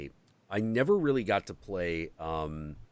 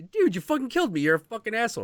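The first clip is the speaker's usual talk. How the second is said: much higher, louder furious outburst; outraged yell; 13 semitones higher, 5 dB louder, much faster than usual. Retold or imitated. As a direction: scream it like a betrayed player. Retelling player angry at being killed.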